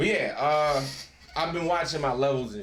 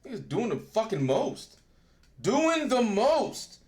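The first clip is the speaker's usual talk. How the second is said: exasperated; 9 semitones higher, 5 dB louder, slower than usual. Real, not acted. Exasperated rant about friends.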